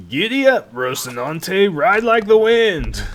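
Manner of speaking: high, loud rider's cry, slow on the end; yelling giddy up. Acted. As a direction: whoop the horse into a gallop. Spurring the horse on, yelling.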